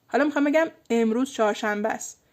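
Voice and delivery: ordinary talk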